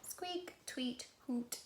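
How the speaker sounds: gentle storybook critter sounds; animal calls. Acted. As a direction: softly voice squeak, tweet and hoot as animal calls. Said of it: "say the animals".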